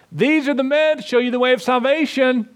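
high, sneering mock of the girl; caustic mockery. Preacher mocking the possessed girl's taunting cry, high and sneering. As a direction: mimic her scornful, mocking tone. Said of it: "It's mockery. She's being caustic".

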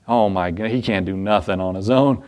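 ordinary talk, nothing marked

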